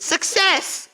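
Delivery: high, excited cry of success; imitating proud friend. Classmate celebrating the experiment, high excited cry. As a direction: squeak it out like a proud kid. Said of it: "and he went".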